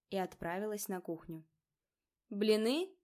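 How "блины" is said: loud call after someone; calling after her. Acted. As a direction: call it out down the hall. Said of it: "крикнул вдогонку брат". (громкий окрик вдогонку)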